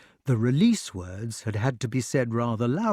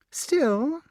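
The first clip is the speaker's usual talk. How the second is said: witch voice. A narrator's reading. Witch speaks.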